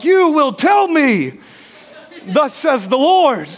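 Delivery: hammed-up thus-says-the-Lord voice; husband commanding his wife like a prophet, high and slow; mock prophetic command. Demanding answer like a prophet.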